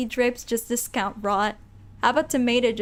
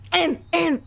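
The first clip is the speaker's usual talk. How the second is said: zombie throat clearing; 6 semitones higher, 5 dB louder than usual. Acted. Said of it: "that's how he's gonna talk".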